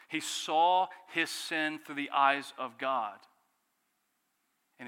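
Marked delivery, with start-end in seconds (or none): 0.1-3.2 slow and louder; emphatic climax. Acted. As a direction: drive home each word. Climax of Paul's conversion.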